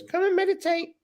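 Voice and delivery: very high, squeaky put-on voice; talking pyramid. Pyramid calling host to meditate, high put-on voice. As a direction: pipe it up high, as a little object calling. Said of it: "I look at it ... and it goes".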